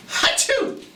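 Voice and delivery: high, breathy mock sneeze; a big pretend achoo for the kids. Cold dinosaur sneezing at Derek's door, a high mock sneeze.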